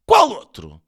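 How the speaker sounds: high, loud startled query; interrupting question. A narrator's reading. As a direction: cut in sharply with the question. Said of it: "interrompeu Sócrates".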